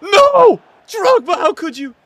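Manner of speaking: a loud, high cry of protest; anguished shout. Real, not acted. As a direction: yell it out in pain. Reacts to opponent's goal.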